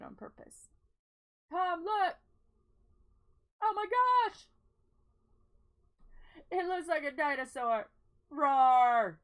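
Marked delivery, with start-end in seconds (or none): 1.5-7.9 loud and thrilled, pitched up; shouting in delight; excited. Real, not acted. Excited at the dinosaur shape. 8.3-9.2 loud playful monster roar; dinosaur roar. Pretend.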